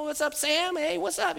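high singled-out hellos; chummy greeting. Retold or imitated. Patient greeting the next familiar face, chummy. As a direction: toss out high friendly greetings.

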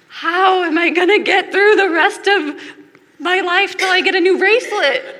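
laughing at her own overreaction; mock despair, laughing. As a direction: ham up the melodrama while laughing. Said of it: "at first I was like".

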